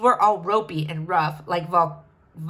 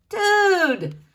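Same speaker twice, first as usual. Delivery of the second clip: stoned shaman drawl; 9 semitones higher, 4 dB louder than usual. Acted. Laid-back shaman greeting.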